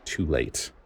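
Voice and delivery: usual speaking voice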